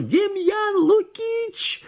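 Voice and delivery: very high, drawn-out call; doctor wailing for the medic. A narrator's reading. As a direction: howl the name down the corridor. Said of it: "завопил".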